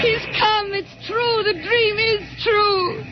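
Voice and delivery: the dream made real; awed excitement. Acted. Wife sees the rocket.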